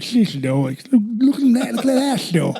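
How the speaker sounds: high-pitched, hammed-up friend impression; John Volante. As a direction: imitate your buddy gawking, drawn out at the end. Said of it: "He talks like this".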